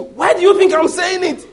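very high, loud retort; defiant shout. Retold or imitated. Man of God retorts about the devil hearing, shouting defiantly. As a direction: fire it back at full pitch. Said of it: "so he shouted loud again".

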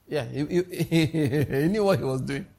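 chuckling through the words; real amused laughing. Real, not acted. Preacher joking about Jesus before photography, laughing. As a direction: let the laugh break up the sentence.

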